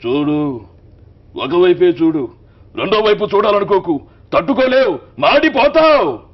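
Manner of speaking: building from loud to a huge, slow high roar; Balakrishna punch dialogue. Retold or imitated. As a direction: warn the villain, rising to an explosive threat. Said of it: "ఈ range ఉంటుందండి".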